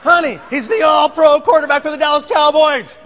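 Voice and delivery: high, loud shouting; exasperated excitement. Retold or imitated. Husband explaining the famous player to wife, excited shouting. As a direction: yell it as if it were obvious.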